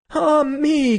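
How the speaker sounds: high, loud wail of despair; anguished cry. Acted. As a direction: cry out as if struck. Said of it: "cried the wife".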